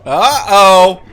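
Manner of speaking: loud, high uh-oh; surprised uh-oh. Real, not acted. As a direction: react to the slip out loud. Player made a mistake.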